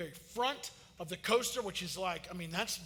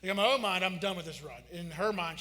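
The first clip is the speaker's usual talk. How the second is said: ordinary talk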